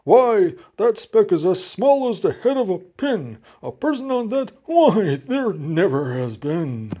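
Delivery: emphatic disbelief, slowing at the end; sour kangaroo. A narrator's reading. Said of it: "'Twas a sour kangaroo".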